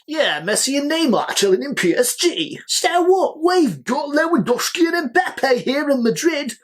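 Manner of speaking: high, smug mock boast; mock Madrid brag. Real Madrid taunting PSG, high smug mock boast. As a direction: lord it over PSG in a high teasing voice. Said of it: "it would almost be like".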